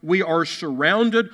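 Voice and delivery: normal talking voice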